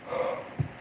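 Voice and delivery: real yawning exhale. Real, not acted. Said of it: "I yawned".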